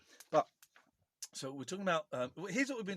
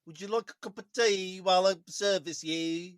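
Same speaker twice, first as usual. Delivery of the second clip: attempted Midlands accent; 3 semitones higher, 8 dB louder than usual. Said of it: "a Midlands one isn't as bad".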